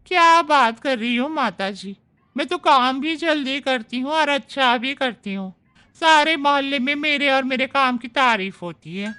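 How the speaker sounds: chatty, self-praising house maid; maid's voice. Acted. Maid Manju defends her work to Shobhna, chatty and boastful. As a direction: boast about your own work.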